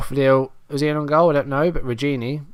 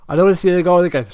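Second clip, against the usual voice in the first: stretched by a real yawn, louder and higher; words pulled out of shape by a yawn; yawning through words; 6 semitones higher, 7 dB louder, faster than usual. Real, not acted. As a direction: yawn mid-sentence. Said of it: "Sorry, I'm yawning".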